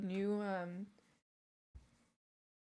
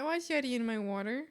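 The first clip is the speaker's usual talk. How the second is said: ordinary talk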